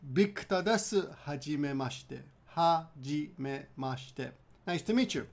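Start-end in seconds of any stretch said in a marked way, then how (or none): 2.5-4.3 slow. Shown as a demonstration. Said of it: "say your name very simply".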